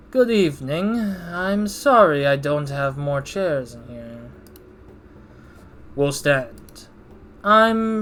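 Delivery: slow, smug, drawn-out boss voice; slow punk. Acted. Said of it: "I'm also reading this slow because I want him to be a punk".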